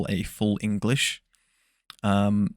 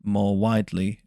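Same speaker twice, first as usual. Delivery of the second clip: ordinary talk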